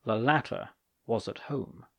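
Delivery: ordinary delivery, nothing marked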